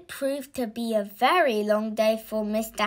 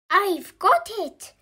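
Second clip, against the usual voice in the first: high, triumphant exclamation; excited cry; 9 semitones higher, 4 dB louder than usual. A narrator's reading. Mister Happy rushes in with his idea, excited cry. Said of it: "cried Mister Happy".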